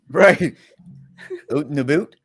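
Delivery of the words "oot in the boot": teasing Canadian "out and about"; playful Canadian accent, asked as a question; mock Canadian accent.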